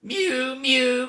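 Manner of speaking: high mewing cat. A narrator's reading. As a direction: mew like a cat at the door. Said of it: "cried Simpkin".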